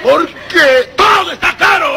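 put-on high, shouted kid-and-dad exchange; bad-tempered father. Mean father answering his child, hammed-up angry shouting. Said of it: "hay padres que son malos".

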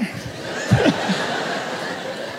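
laughter. Real, not acted. Laughs after the mother story.

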